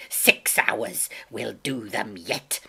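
exclaim, then slow into determination; crying out. A narrator's reading. Captain springing to his feet, urgently defiant. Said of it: "he cried".

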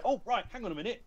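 high-pitched retold reaction; mock sudden interest. Imitating game makers on a Skype interview, high and interested.

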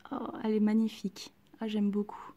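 ordinary talk

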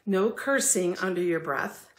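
normal talking voice

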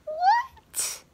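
a startled cartoonish squeak; mock surprise. Mock shock at own answer.

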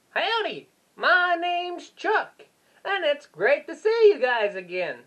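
high-pitched howdy character voice; Chuck. Pretend. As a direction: greet up high, stretching the name. Introducing himself as Chuck.

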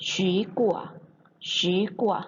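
"ฉีกัว" with a rising second tone on the first syllable. Shown as a demonstration. Teacher modelling the Chinese word for fruit, "ฉี" in rising second tone. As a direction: say "ฉีกัว", lifting ฉี in Mandarin second tone. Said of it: "ออกเสียงข้างหน้าเป็นเสียงสอง".

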